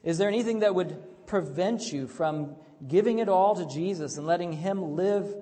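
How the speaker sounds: rousing pulpit delivery; full-force preaching. Acted. Preaching the sermon.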